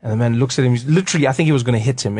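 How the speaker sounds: ordinary delivery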